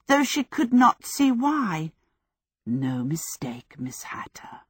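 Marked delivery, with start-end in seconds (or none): 2.7-4.6 menacing whisper. Acted. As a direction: breathe the threat softly. Witch delivers a threat.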